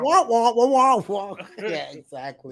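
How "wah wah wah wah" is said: higher cartoon trombone wah wah; Charlie Brown teacher. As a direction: wah it like a muted horn. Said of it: "Charlie Brown's teacher".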